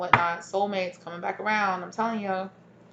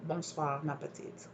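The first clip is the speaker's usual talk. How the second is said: soft French; 3 semitones lower, 13 dB quieter than usual. Retold or imitated. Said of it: "they're saying".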